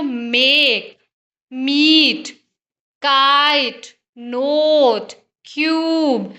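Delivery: मेक, मीट, काइट, नोट, क्यूब with vowels held extra long; enhanced long vowels. Shown as a demonstration. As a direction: draw each vowel right out, one word at a time. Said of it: "उसका further enhance होके sound आ रहा है". (स्वर को और लंबा खींचकर)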